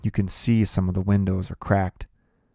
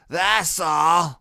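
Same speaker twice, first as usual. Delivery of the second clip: put-on slurred drunk; 10 semitones higher, 4 dB louder than usual. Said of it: "her voice is slurpy".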